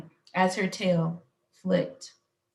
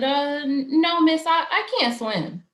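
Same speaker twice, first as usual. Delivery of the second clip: higher, stammering reluctance; halting, uneasy little girl; hesitant; 7 semitones higher than usual. A narrator's reading. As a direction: stumble over a timid refusal. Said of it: "Suki was a little hesitant".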